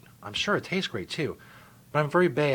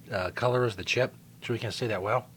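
normal talking voice

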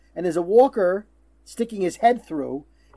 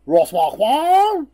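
mock announcer; 2 semitones higher, 6 dB louder, slower than usual. Announcing next episode guests.

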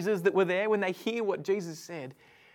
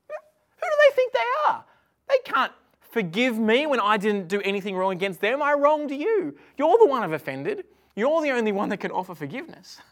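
mock outrage; 3 semitones higher, 7 dB louder than usual. Car owner protesting a stranger's forgiveness, hammed-up indignation. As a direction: burst out, then keep protesting loudly.

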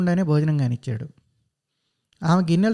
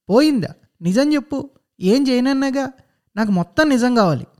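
sharp, higher, louder questions; demanding mother; 6 semitones higher, 3 dB louder than usual. Acted. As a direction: press him hard for the truth. Mother interrogating son. (గట్టిగా నిలదీస్తున్న తల్లి)

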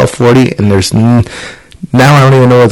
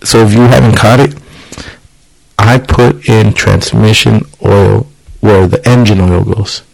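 usual speaking voice

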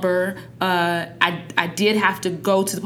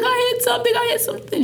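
much higher, imitating a panicked teen; imitating crying daughter; faster than usual. Mother imitating her crying daughter after the crash, much higher. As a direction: squeak it out like a frightened teen. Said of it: "she came in the house crying".

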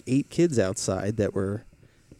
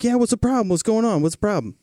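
aggressive neighbor; 10 semitones higher, faster than usual. Retold or imitated. Retelling how the neighbor confronted him, imitating his aggression. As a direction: mimic the angry neighbor squaring up.

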